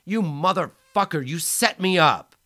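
high, loud enraged yell; furious shout. Acted. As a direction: scream the betrayal. Lars furious, betrayed.

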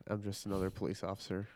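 ordinary delivery

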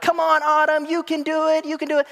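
put-on high, loud kids calling encouragement; friends cheering her on. Friends in the lake urging the girl to jump, high cheering. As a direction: call up to her like excited friends in the water. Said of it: "they're like".

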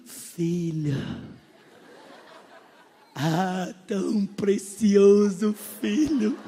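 hammed-up gentle minister voice; mock tender pastor. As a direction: coo over the flock like a syrupy preacher. Mimicking a tender preacher greeting.

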